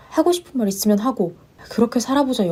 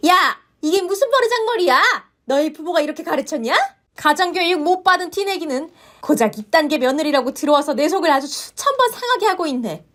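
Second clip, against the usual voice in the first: yelled, much higher; screaming mother-in-law; 6 semitones higher than usual. Acted. Mother-in-law yells at the daughter-in-law about upbringing. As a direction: let the rage boil over.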